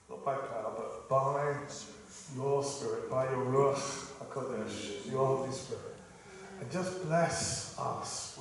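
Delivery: hushed earnest prayer. Acted. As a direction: pray gently over the room. Leads a prayer.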